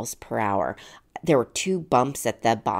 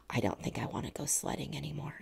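much softer, fast little voice; scared son; 12 dB quieter, faster than usual. Retold or imitated. Scared son after the crash, very soft and quick. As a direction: say it small and fast, shaken. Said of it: "he said".

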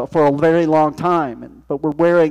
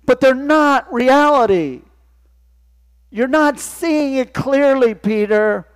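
preaching rebuke, building; 4 semitones higher than usual. Acted. Jesus' rebuke read with building force.

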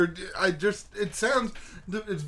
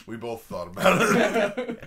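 mock clone voice. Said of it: "He's like".

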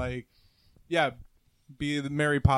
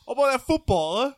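put-on British accent; 9 semitones higher, 6 dB louder than usual. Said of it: "if you go to London".

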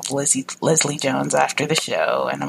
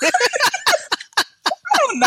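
laughing; much slower than usual. Real, not acted.

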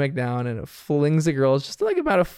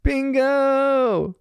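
put-on kid yell; 9 semitones higher than usual. Bluey sliding toward her sister, high kid yell.

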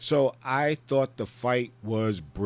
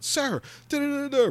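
soldier rattling off jargon. Retold or imitated. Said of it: "rattling off this military jargon".

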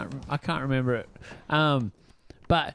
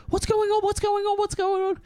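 much higher, frantic repeated question; panicked sister. Retold or imitated. Sister answering a daytime call she dreads, frantic high panic. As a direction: fear that someone has died. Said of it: "it's like".

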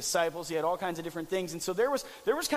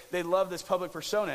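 usual speaking voice

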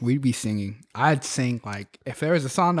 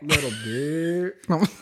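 mock insult; 3 semitones higher, much slower than usual. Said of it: "You're all like".

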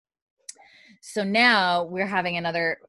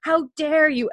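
mock angry outburst; 5 semitones higher than usual. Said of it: "she loses her shit a bit".

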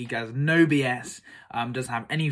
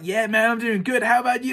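casual reply voice; 8 semitones higher, 5 dB louder than usual. Retold or imitated. Voicing the guru's reply.

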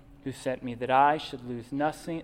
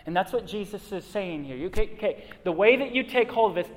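ordinary talk, nothing marked

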